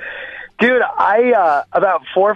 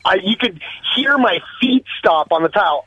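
ordinary delivery

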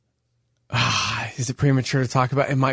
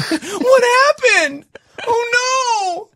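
panicked; 4 dB louder, much slower than usual. Retold or imitated. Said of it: "it's like".

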